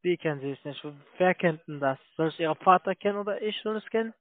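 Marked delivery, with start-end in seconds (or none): none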